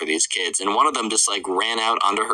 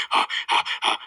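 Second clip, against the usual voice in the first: unvoiced huffs of a sprinter; imitated panting. Boy flees down the mall, mock panting. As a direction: pant as if running hard. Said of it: "is, like, sprinting".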